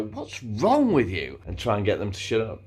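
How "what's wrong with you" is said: "wrong" drawn out long and raised; slow the line and lean on "wrong"; stress on wrong. Shown as a demonstration. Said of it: "you just go".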